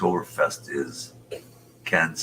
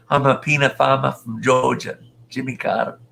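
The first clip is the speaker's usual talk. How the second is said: Jimmy Carter impression; 8 semitones higher, 5 dB louder than usual. To a viewer, joking about Carter, as a higher, louder mock Carter. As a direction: ham it up as Carter the peanut farmer.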